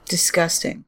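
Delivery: ordinary talk, nothing marked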